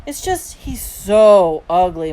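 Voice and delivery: loud, drawn-out complaint; childish complaint. A narrator's reading. Little brother explains himself to mom, complaining. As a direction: drag it out like a small child.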